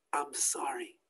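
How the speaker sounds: a broken, hushed apology; tearful apology. Acted. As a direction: smuggler whispering sorry through tears. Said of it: "he starts crying".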